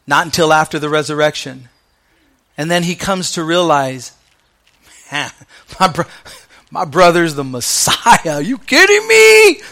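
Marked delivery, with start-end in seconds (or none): 5.1-9.6 stunned, can't believe it; astonished realization. Retold or imitated. James realizing his brother is the Messiah, amazed.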